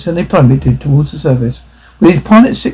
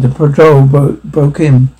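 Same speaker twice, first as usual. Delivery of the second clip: ordinary delivery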